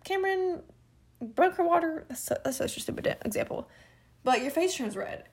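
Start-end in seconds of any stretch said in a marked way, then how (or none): 0.0-2.0 high teasing schoolkid voice; a high mocking taunt from classmates. Classmates tease her after the spill, in a high mocking voice.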